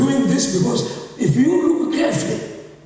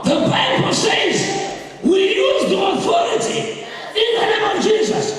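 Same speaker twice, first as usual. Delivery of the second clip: preaching shout; 10 semitones higher than usual. Real, not acted. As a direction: proclaim it at full force.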